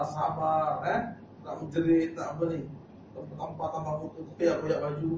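usual speaking voice

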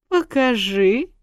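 slow, intrigued request; interested. A narrator's reading. Tipsy wife begs husband to show her, interested. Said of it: "заинтересовалась".

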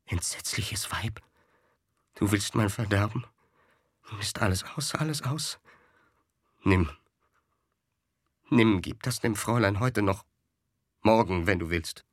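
hushed, desperate muttering; quiet murmur. A narrator's reading. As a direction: start almost whispered, murmur it urgently. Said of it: "Dies leise murmelnd". (leise murmelnd)

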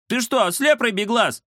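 high indignant squeak; outraged little cat protesting. Acted. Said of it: "возмутился Кузя".

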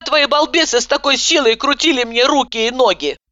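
high indignant wail; wailing complaint. A narrator's reading. Patient wails about the orderlies. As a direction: complain bitterly.

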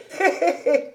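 high tittering laugh; acted old woman's chuckle. Housekeeper teasing master about his figure, high chuckle.

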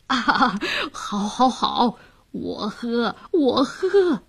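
chuckle, then rise on the last agreement; drunken man happily giving in; tipsy, delighted official. A narrator's reading. Official accepting the fishwife's toast, tipsy and pleased.

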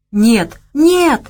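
high, loud frightened plea; begging. A narrator's reading. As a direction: plead desperately. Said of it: "взмолился тот".

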